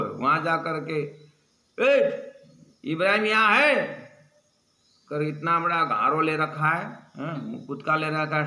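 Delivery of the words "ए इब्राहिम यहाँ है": loud high shout. Retold or imitated. Angry man shouting for Ibrahim at the gathering, loud. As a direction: call out the name with force. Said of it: "जाए के किया शोर".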